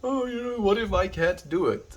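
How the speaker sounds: high, wavering mock worry; mock self-doubt. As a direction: voice your doubts in a high pitch. Voicing own self-doubt, then answering it.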